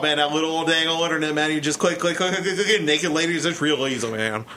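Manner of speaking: rapid mumbled Texan rambling; Boomhauer. Acted. Cohost imitating King of the Hill character, fast Texan mumble. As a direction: race through it in a slurred Texas patter. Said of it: "that one dude from King of the Hill".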